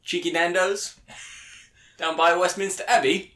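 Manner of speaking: mock London accent, pitched well up; a cheeky British accent, mostly high. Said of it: "You nailed it".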